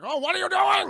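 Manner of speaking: high, loud furious yell; angry shout. Retold or imitated. Zod storming in on Jor-El, high furious shout. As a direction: blast it at him in rage. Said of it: "he's like".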